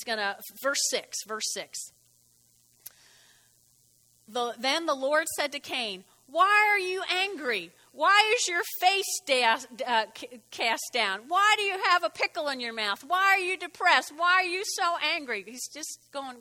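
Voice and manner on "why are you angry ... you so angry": higher, louder exasperated questions; mock-stern God scolding; God questioning. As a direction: press him, why why why. God questioning Cain, voiced.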